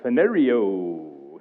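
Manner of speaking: "phanerio" slowly, sliding down on an endless O; extended final O. Retold or imitated. Pastor imitating his Bible program's audio, "phanerio" with a long extended O. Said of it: "It's like extends the O".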